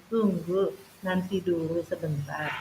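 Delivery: pelan dan lembut, lebih lirih; nada halus dan rendah. Retold or imitated. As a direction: menenangkan anak dengan lembut. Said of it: "nada yang halus, nada yang rendah". (guru menenangkan murid yang marah, lembut dan pelan)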